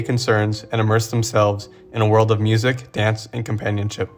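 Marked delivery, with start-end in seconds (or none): none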